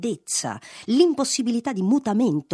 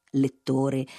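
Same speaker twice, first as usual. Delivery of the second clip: ordinary delivery, nothing marked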